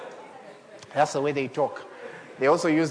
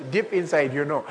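ordinary delivery

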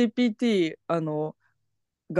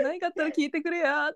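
friendly canned sign-off; 8 semitones higher, 4 dB quieter than usual. Retold or imitated. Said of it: "フレンドリーだけどテンプレで終わらせようとしてる".

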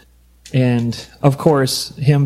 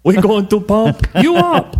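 Schwarzenegger impression; 7 semitones higher than usual. Joking with friends about the note, in a hammed-up Arnold voice. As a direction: pump it up like Arnold.

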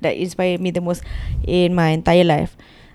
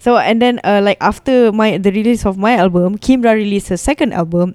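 normal talking voice

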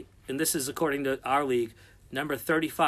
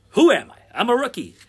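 quiz host announce; 9 semitones higher, 12 dB louder than usual. Acted.

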